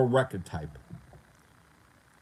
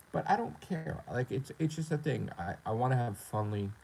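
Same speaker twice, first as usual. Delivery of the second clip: ordinary delivery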